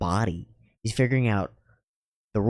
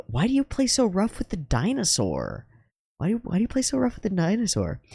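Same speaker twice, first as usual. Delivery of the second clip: higher, gently puzzled dad; imitating the father; 11 semitones higher than usual. Father asks his son about rough play, higher puzzled voice. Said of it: "his father went".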